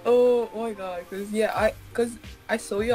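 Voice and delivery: ordinary talk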